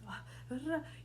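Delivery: startled intake of breath; gasp. Shown as a demonstration. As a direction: gasp at the sight. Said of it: "and you gasp".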